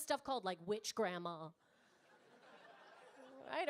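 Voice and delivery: usual speaking voice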